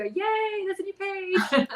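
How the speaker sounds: high, squealing fan excitement; excited fan. Retold or imitated. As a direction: gush like an eager reader. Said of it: "people that email me ... and go".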